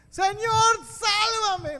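high, loud anguished plea; desperate cry. Acted. Preacher voicing Pedro sinking in the storm, anguished cry. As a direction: scream it like a drowning man.

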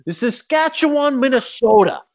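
high, pompous analyst voice; hammed-up broadcast analyst; mock hockey analyst. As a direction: lampoon a know-it-all commentator. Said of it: "Pierre is like".

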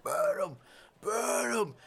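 high, strained mob yells; bay for blood like a frenzied crowd; frenzied cries building to a scream; screaming soldiers. Acted. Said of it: "Soldiers start screaming".

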